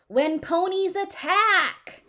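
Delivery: a high, slow, loud holler of the title. Acted. A girl hollering the TV show's title at her parents, high and loud. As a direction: yell the show name out high and slow. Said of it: "I hollered".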